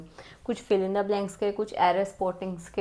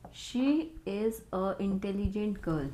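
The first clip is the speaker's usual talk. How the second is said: slow dictation reading; 3 dB quieter, much slower than usual. Shown as a demonstration. Teacher slowly reads an error sentence to her students, very slow and deliberate. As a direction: read the sentence out very slowly, word by word. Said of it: "इसमें आपको बताना है कि इसमें कहाँ पे कौन सा आर्टिकल".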